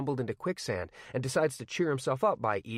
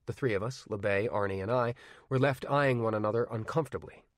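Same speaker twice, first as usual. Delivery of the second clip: usual speaking voice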